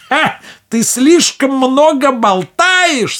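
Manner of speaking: high, loud, slow scornful witch; old witch. Acted. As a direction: cut her off with scorn. Witch cuts her off.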